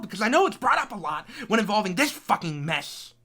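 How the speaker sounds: ordinary talk, nothing marked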